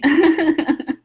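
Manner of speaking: bright burst of laughter; laughing. Real, not acted. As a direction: laugh out loud at your own joke. Laughs after teasing listener.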